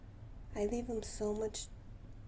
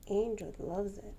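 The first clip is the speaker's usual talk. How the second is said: normal talking voice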